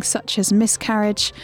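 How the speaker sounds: normal talking voice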